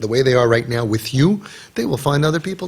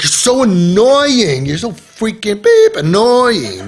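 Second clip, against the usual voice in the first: loud, slow, whiny complaint, pitched up; annoyed teen whine; 6 semitones higher, 6 dB louder, much slower than usual. Retold or imitated. Teen daughter complaining to her father in the car, loud and whiny. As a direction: drag out annoying like a fed-up teenager. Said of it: "and she's like".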